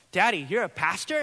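put-on high, questioning toddler voice; a small child asking her dad; little daughter's voice. Pastor voicing his toddler asking if he's a pastor.

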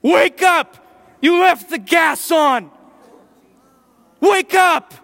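high, loud frantic yelling; panicked wife screaming him awake; wife shouting. Retold or imitated. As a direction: yell to wake a sleeping man. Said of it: "I wake up to her shouting".

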